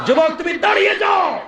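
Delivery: high-pitched, full-throated preacher's cry; rousing shout. Acted. Preacher urging the youth to stand before the tiger, high rousing shout. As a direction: call out high as a rallying command.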